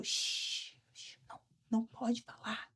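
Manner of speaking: whispered shushing; imitated hushing whisper. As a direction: hiss her into silence. Coworker hushing her at reception. (sussurro imitado mandando calar)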